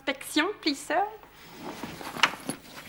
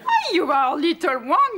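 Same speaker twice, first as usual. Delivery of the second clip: ordinary talk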